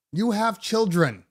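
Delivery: ordinary talk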